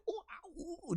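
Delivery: put-on high, breathy little baby squeaks; baby squeaky noises. Imitating baby Wolfie's coos to his chat. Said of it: "doing his little squeaky noises".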